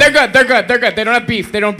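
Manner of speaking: loud hurried reassurance; flustered backpedal. Real, not acted. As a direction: rush to smooth it over.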